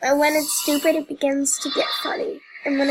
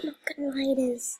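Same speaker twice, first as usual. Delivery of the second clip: hushed admission, barely voiced; quiet real confession; confiding whisper; 7 dB quieter than usual. Real, not acted. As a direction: whisper it under the breath. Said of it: "that's kinda scary".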